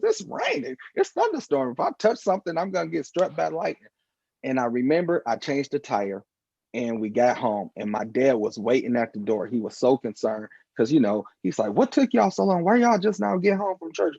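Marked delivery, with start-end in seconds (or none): 0.0-3.9 put-on high-pitched panicky kid voice; boy scared of being struck while changing the tire, high panic; retold fear. Kid's fear of lightning in storm. 11.7-14.2 higher, lightly voiced anxious father; a hint of a fretting dad; father waiting at the door for his late family, mildly fretting; worried dad.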